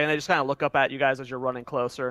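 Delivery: normal talking voice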